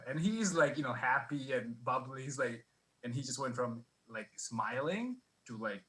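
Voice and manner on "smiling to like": normal talking voice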